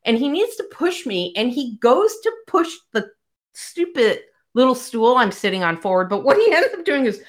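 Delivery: high voice, laughing throughout; real embarrassed laughter; laughing retelling. Real, not acted. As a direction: tell it cracking up.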